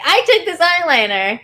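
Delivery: put-on high, loud kid brag; bragging. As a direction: boast like a proud kid. Child bragging to parents.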